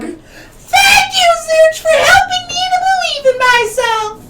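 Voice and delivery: tearful shout. Acted. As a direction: sob it out with joy. Miriam character hugs, crying.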